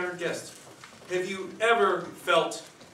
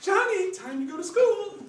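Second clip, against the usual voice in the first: much higher calling mom voice; mother's voice; 4 dB louder than usual. Acted. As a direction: call him awake like his mother. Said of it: "I heard my mother knocking".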